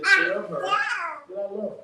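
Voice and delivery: high, slow, otherworldly voice of God. Retold or imitated. God speaking to the preacher, slow and lofty. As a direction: draw it out slowly as God speaking. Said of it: "God said".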